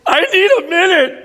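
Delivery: crying man waving off his friend; overwhelmed plea. Retold or imitated. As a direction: choke it out while holding back tears. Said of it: "I'm like".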